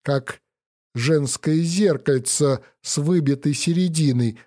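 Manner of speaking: ordinary delivery, nothing marked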